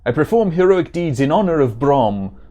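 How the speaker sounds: emphatic put-on German accent; hammed-up German hero. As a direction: proclaim it as a German-accented warrior. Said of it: "supposed to be a German accent".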